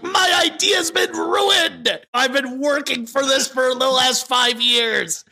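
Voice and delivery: high, slow, hammed-up shout of despair; mock outraged shout. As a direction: wail it like a ruined movie exec. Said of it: "was like".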